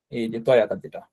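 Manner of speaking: ordinary delivery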